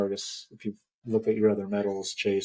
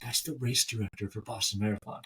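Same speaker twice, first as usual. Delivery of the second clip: softer, confidential quoted tip-off; discreet aside; 7 dB quieter than usual. Retold or imitated. Coworker nudging her friend about the race director, hushed. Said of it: "nudges me and she goes".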